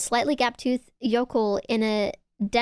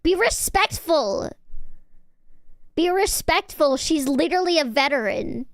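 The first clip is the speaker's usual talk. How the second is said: high, raised-voice scolding; mock scream; 5 semitones higher than usual. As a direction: indignantly demand respect for the professor. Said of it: "My chat loves when I fucking scream at them".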